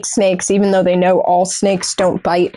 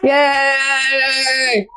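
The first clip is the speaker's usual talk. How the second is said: cheering; 3 semitones higher than usual. Real, not acted. Mock cheer for the dog.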